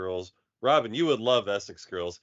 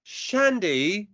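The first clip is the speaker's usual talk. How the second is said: surprised; 9 semitones higher, 5 dB louder than usual. Real, not acted. Reacting to the name.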